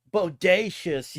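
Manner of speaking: drawn-out surfer-slang exclamation; Michelangelo. Retold or imitated. Joking about funny old slang, in Michelangelo's drawn-out voice. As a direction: stretch the word out in character. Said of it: "I sound like fucking Michelangelo".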